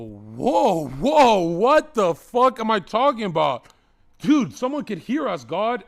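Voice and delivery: loud, high panicked whoa; hammed-up panic; alarmed loud protest. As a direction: blurt it out in alarm. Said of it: "And I go".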